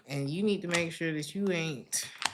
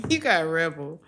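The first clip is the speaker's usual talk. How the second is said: ordinary talk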